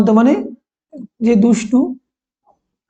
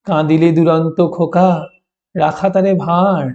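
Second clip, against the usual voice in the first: poem recitation. Acted. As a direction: read the verse aloud to the class, unhurried. (ধীরে কবিতা আবৃত্তি)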